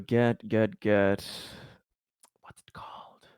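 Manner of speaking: ordinary talk, nothing marked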